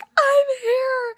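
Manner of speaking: high, loud excited squeal; thrilled shriek of arrival. Retold or imitated. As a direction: squeal it like you just arrived. Friend excitedly announcing arrival.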